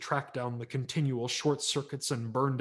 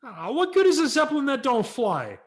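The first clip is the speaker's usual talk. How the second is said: put-on high, loud indignant kid voice; kid brother retort; 14 semitones higher, 10 dB louder than usual. Kid brother answering his older brother, high indignant. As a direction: whine it back, offended.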